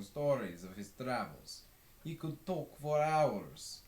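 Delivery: fond, easy barroom reminiscence. A narrator's reading. Westerberg telling the listener about Alex, fond and easy. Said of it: "says Westerberg".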